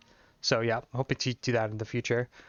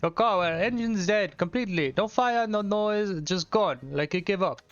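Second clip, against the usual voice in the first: higher hammed-up French accent, ending each line on a lilt; 4 semitones higher than usual. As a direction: play the sly village mechanic in a corny French accent. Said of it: "rate my French accent".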